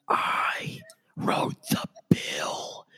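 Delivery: high breathless whisper; manic whisper. Retold or imitated. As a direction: whisper it like a manic old man. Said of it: "the whispering routine, the manic whisperer".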